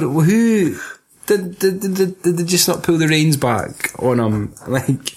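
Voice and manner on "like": usual speaking voice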